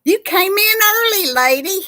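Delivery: high, loud, delighted hello; excited greeting. Real, not acted. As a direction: greet her with real delight. Greeting a viewer joining live chat.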